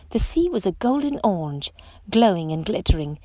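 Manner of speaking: usual speaking voice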